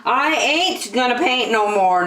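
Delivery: ordinary delivery, nothing marked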